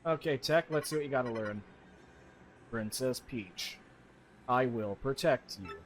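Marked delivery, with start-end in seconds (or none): 2.7-5.8 slow, low, devoted machine voice; low, slow creepy TEC; creepy computer. Acted. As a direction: promise it like an eerie computer. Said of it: "Little creepy there, Tech".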